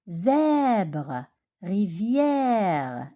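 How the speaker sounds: Zèbre, rivière with a long open è, falling; open è demo. Shown as a demonstration. Teacher shows pupils the open sound, pronunciation demo. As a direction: stretch the è in Zèbre and rivière, mouth slightly open. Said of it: "Le son est ouvert".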